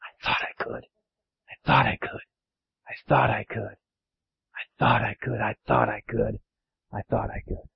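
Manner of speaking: hushed, near-whispered refrain; very quiet low murmur, almost whispered; contented puffing. Acted. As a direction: puff it softly, winding down. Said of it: "seemed to say, as she puffed steadily".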